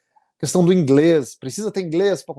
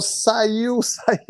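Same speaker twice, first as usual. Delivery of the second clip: higher, breaking into a laugh; wife's tearful joy, retold; 6 semitones higher than usual. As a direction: quote the wife's news with a laugh. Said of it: "Daí ela falou". (esposa contando a notícia, rindo, voz mais aguda)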